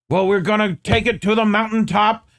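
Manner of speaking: a touch of a church preacher taking it to the mountaintop; mock preacher. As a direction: lightly imitate a pulpit sermon. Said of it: "classic fire and brimstone".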